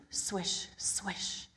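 soft swishing leaves. Acted. Sound effect of leaves.

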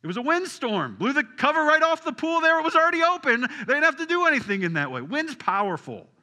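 loud, high, driving pulpit voice; full-force preaching. Acted. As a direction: preach the storm with full power.

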